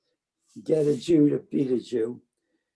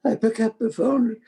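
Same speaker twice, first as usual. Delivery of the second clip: "I pick up the phone" voice rising high and tearful; fighting back real tears; choked up; 8 semitones higher, 4 dB louder than usual. Real, not acted. Telling the interviewer about calling his father, near tears. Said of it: "I'm gonna cry over this".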